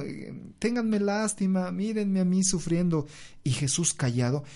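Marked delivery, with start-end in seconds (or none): 0.6-3.1 high, whiny mock plea for pity; mock self-pity. Mocking her own complaints to the listener, whiny and high. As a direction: ham up a self-pitying moan, pitched high. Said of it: "así como".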